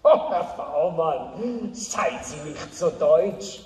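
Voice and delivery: slow, drawn-out Swiss German; Swiss German accent. Acted. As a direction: Swiss accent, savoring each word. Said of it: "aber ich bin ein Schweizer".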